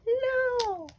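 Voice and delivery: tiny, very high squeal of refusal; squeaky sticker voice. Pretend. Sticker refusing to be pulled off, tiny squeaky voice. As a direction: squeak it like a little cartoon critter.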